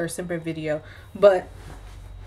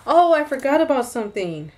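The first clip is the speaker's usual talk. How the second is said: higher-pitched surprised "oh"; sudden realization; 6 semitones higher than usual. Real, not acted. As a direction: blurt it out as you suddenly remember. Suddenly remembers an omitted part.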